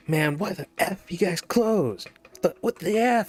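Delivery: high-pitched, drawn-out imitation of an irate customer; upset customer. Customer at the closed store's door, imitated upset. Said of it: "clearly he's upset".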